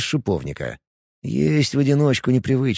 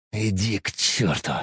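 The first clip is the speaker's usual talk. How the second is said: low, softer hoarse growl; 3 semitones lower, 6 dB quieter than usual. A narrator's reading. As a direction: rasp it out to chase the voice away. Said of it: "хрипло рычу я".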